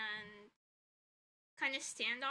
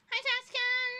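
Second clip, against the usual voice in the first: excited greeting; 11 semitones higher, 8 dB louder than usual. Real, not acted. Greeting chat user by name.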